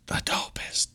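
breathy aside, leaning in to whisper. Real, not acted. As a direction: drop to a hushed whisper. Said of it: "Evan doesn't like it when I whisper".

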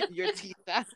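high-pitched, through laughter; laughing. Real, not acted. Co-host repeats the funny role name, laughing. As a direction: say it while cracking up.

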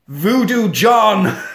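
Voodoo John stretched out slow and loud; slow, loud dramatic naming; slow grand reveal. Acted. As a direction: announce him like a movie title.

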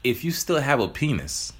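usual speaking voice

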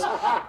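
long, drawn-out mock war cry; imitated roar. Big man bursting from his screen door, imitated roar. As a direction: imitate an enraged man's yell. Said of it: "ponytail just goes".

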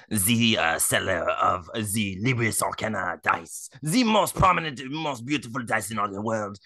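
hammed-up sultry French accent; sexy French accent. Podcaster improvising a dice subscription ad on request, sexy French accent. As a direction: sell it seductively with a French accent. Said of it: "do it in a French accent".

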